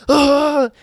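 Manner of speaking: high loud scream of alarm; startled yelp. Retold or imitated. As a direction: yelp in panic. Startled yelp while fleeing.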